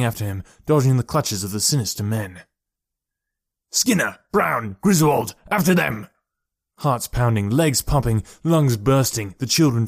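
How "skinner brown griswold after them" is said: raised, urgent command; barked orders. A narrator's reading. Gang leader sends men after fleeing children, barked orders. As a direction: call your men into the chase.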